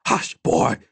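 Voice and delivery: high, sharp hushing; snapped. A narrator's reading. Old woman silences the boy, snapping. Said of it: "Temba snapped".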